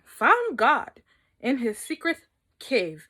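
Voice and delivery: ordinary delivery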